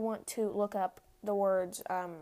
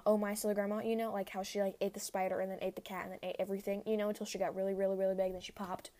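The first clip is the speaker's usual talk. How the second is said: normal talking voice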